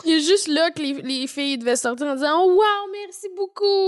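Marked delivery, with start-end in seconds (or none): 2.4-3.9 high, drawn-out mock gratitude; mock gushing thanks. Girls leaving the dentist with tooth diamonds, mock gushing. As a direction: gush it slowly, pitched up, hammed-up. Acting grateful patients with diamond teeth.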